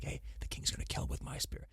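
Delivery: hushed, excited whisper of the spearmaker. Acted. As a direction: breathe it out like a secret boast. Voicing the proud spearmaker.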